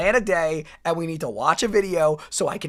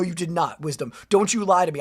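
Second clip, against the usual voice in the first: ordinary talk, nothing marked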